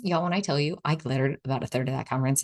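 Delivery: normal talking voice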